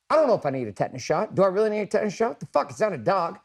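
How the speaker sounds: hammed-up panic over a tetanus shot; freaking out. Himself at urgent care before a tetanus shot, retold as fast, high panic. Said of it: "I'm freaking the fuck out".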